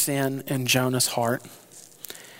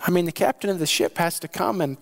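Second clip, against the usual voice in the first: normal talking voice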